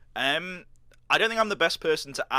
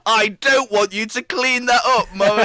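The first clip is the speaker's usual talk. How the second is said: mock child; 7 semitones higher, 9 dB louder than usual. Imagined child refusing Mummy's help, hammed-up whine. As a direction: play a kid protesting to Mummy.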